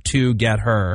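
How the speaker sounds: "to get her" as three distinct words; broken down. Shown as a demonstration. Host repeats the spelling trick, separated "to get her". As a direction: split together into "to get her". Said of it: "the trick was".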